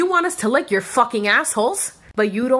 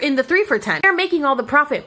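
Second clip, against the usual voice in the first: normal talking voice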